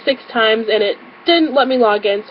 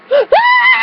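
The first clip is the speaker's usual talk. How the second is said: very high, short shriek of disbelief; high-pitched delighted squeal; excited squeal; 3 dB louder than usual. Retold or imitated. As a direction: let out a high happy scream. Acts out gasp and scream at news.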